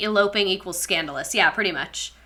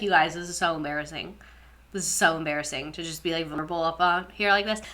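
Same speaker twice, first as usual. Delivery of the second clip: low, soft embarrassed aside; acted embarrassment; 5 semitones lower, 9 dB quieter than usual. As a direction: shrink from the audience, mock-bashful. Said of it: "I would be like".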